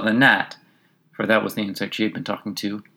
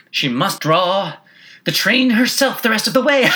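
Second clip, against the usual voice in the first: high laughing passenger voice; 10 semitones higher, 5 dB louder than usual. A narrator's reading. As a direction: laugh through the words.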